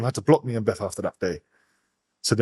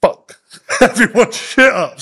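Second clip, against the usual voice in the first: a mock angry crying threat, louder and higher; hammed-up furious crying, laughing through it; 9 semitones higher, 6 dB louder, slower than usual. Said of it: "she's crying like".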